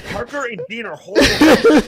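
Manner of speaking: angry yell at the host, rising in pitch; heated yell. Real, not acted. Angry caller yelling at the streamer. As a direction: spit the insult out.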